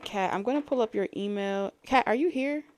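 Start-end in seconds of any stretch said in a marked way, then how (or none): 1.8-2.6 louder, higher call for Kat. Real, not acted. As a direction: call out to see if she's there. Calling out to absent viewer.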